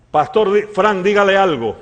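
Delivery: loud, high urging; urgent prompting. Retold or imitated. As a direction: imitate an insistent woman calling on someone. Lady urges pastor to speak.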